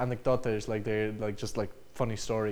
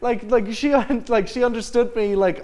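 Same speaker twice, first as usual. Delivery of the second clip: much higher and louder, slowed with amazement; stunned disbelief; 10 semitones higher, 9 dB louder than usual. Retold or imitated. Telling Eugenia she understood her all along, in high loud astonishment. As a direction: burst out in shocked realisation. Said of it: "I was like".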